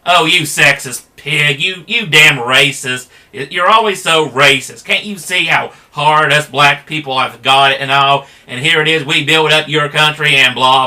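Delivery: hammed-up accusing yell, mocking the accuser; mock name-calling rant. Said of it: "they're just gonna result to name-calling".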